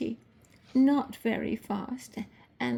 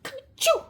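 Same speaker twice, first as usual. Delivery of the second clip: loud high sneeze, achoo; a sudden squeaky sneeze; 6 dB louder than usual. A narrator's reading. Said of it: "Presently Peter sneezed".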